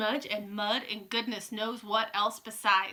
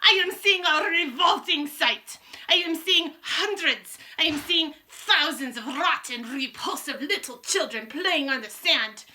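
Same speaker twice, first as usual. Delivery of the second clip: ranting witch; 6 semitones higher, 6 dB louder than usual. A narrator's reading.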